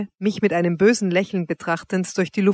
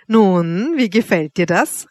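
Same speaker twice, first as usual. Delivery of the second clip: a louder, taunting call; mocking call; 4 dB louder, slower than usual. A narrator's reading. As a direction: taunt him as you call out. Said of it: "rief sie". (höhnischer Ausruf)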